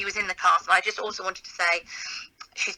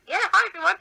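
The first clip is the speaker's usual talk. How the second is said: very high, chirpy hello; mock cheery greeting; 13 semitones higher, 4 dB louder than usual. Performer retells greeting the audience after missing the cue, mock cheery. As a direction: act out an oblivious, over-bright stage hello. Retold oblivious greeting from the stage.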